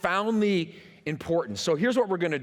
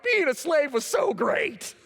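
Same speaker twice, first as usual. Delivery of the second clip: much higher mock shout of fake delight; sarcastic over-the-top cheer, pitched way up; sarcastic mock gush; 10 semitones higher, 3 dB louder than usual. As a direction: yell it like a swooning fan of slavery. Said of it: "So they're like".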